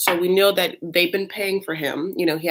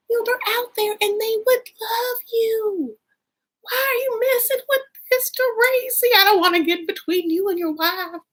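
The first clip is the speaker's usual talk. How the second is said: Southern mom. Retold or imitated. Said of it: "she's just like".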